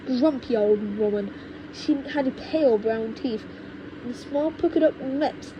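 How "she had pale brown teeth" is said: ordinary delivery, nothing marked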